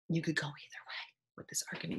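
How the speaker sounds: fast, soft conspiratorial whisper. Real, not acted. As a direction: let them in on a secret, quickly. Confides a secret aside.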